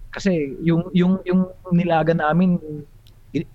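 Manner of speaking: usual speaking voice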